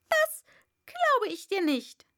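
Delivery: high, loud first word, then emphatic; sharp bird retort; disbelieving pigeon. A narrator's reading. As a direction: reject it as an indignant pigeon. Pigeon flatly disbelieves Alice.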